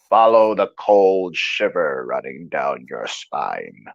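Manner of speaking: low, slow spectral voice; haunting low ghostly beckon; eerie ghost voice. Acted. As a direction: lure them in, slow and low. Spooky quote from game.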